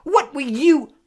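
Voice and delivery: high, loud, broken-off question; shocked. A narrator's reading. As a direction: demand it in utter shock. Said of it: "an expression of complete shock".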